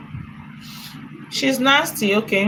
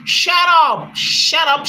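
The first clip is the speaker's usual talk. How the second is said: playful high "shut up" shout; fake-angry yell at chat; mock shout; 6 semitones higher, slower than usual. Mock shushing repeated comment.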